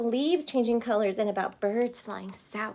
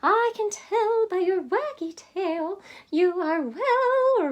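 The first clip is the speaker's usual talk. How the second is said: higher, slow bird voice; chirpy bird; 8 semitones higher, slower than usual. Acted. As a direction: keep the little bird's chirp. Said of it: "she chirped".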